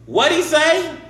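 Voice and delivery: high, loud shouted question; loud, high-pitched deaf old-joke wife; hard-of-hearing wife. Acted. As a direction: yell it like you can't hear.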